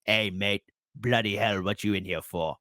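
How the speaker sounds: low, soft British jail-tough voice; terrible British accent. Acted. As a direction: badly fake a British inmate's accent. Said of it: "that's a terrible British impression".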